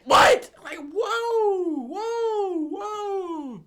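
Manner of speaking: loud shocked high whoa; hammed-up startled exclamations; mock shock. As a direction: recoil loudly as if startled. Mocking mom's reaction.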